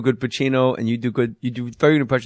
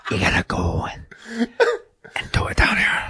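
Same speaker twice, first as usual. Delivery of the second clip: low, slow whispered Walken; 8 semitones lower than usual. Shown as a demonstration. Comic showing where Walken lives, low whisper. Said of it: "go down into the whisper".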